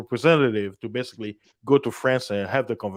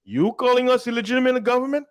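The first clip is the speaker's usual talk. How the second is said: high, incredulous challenge; high indignant retort; mock offended surprise; 11 semitones higher than usual. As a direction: feign shock at the insult. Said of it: "the Mali government is like".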